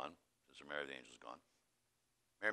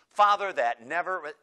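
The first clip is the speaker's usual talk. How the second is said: high, very loud acted protest; loud, affronted nun; indignant denial; 7 semitones higher, 15 dB louder than usual. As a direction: burst out in denial, then break off.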